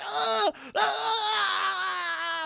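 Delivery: long, high wail; drawn-out shriek of someone burning; agonized acted scream. Doll burning alive, high wailing scream.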